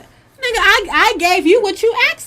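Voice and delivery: high, loud exasperated comeback; high-pitched fed-up retort to the ex; indignant retort. Retold or imitated. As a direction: throw it back at him.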